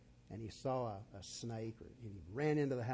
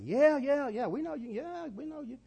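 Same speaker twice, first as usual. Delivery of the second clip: eager crowd; 9 dB louder than usual. Retold or imitated. Crowd cheering the tightrope man.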